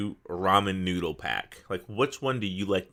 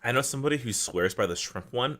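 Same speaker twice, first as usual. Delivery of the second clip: normal talking voice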